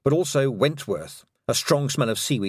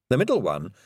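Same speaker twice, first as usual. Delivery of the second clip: ordinary delivery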